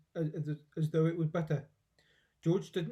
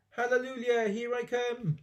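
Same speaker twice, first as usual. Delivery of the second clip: exultant; 8 semitones higher than usual. A narrator's reading. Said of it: "finding her voice at last".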